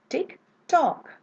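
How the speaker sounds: tick, then fall on "tock"; model tick tock. Shown as a demonstration. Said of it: "So it goes like this".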